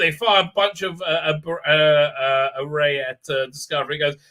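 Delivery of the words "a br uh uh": ordinary talk, nothing marked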